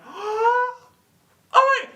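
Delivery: very high, giddy yes; thrilled acceptance. Acted. Fan invited into [name]'s car, giddy squeal.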